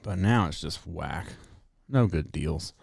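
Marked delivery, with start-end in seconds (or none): none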